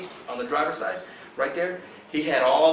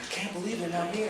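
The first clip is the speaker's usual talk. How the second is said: quoting partner; 7 semitones higher, 5 dB quieter than usual. Retold or imitated. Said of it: "he's going".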